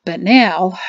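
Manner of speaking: mildly burdened exhale; heavy sigh. A narrator's reading. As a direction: sigh heavily into it. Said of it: "he sighed heavily".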